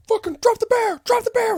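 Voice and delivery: very high, loud yelled command; mock cop shout. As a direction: bark it like a panicked cop. Cops yelling at him.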